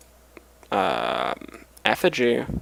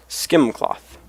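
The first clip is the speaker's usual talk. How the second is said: ordinary delivery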